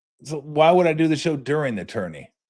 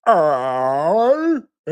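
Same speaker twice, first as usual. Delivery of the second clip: feigned confusion; 5 semitones higher, 6 dB louder than usual. Retold or imitated. Buddy feigning he can't hear.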